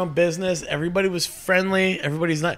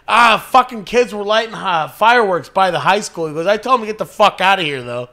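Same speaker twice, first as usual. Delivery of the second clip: loud, higher mock grumble; lightly voiced uncle; 5 semitones higher, 5 dB louder than usual. Uncle fibbing to cop about gunfire, mock-indignant. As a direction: act mildly put out, covering your tracks.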